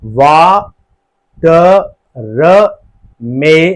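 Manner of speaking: वा, ट, र, मे with र as its own beat; wrongly split syllables. Shown as a demonstration. Teacher miscounting syllables, वा, ट, र, मे split sound by sound. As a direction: separate every sound, giving र a syllable of its own. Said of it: "र अलग से नहीं आता".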